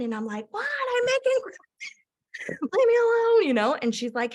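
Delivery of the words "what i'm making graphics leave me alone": much higher, slow, laughing brush-off; busy hype queen, giddy. Retold or imitated. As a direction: wave her off, too busy. Busy making graphics, brushing off upline.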